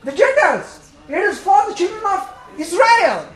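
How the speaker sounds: loud, high fervent preaching; preaching at full force. Acted. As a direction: preach it out to the congregation.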